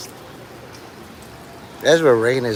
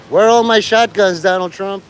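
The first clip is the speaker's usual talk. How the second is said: raised, higher mock-threatening call; loud challenge; 6 semitones higher, 4 dB louder than usual. Real, not acted. Streamer taunting the storm, raised voice. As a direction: bellow it at the sky.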